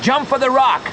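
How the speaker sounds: high urgent command; shouted command. A narrator's reading. Joe shouting to Leon to jump, urgent. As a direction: order the jump.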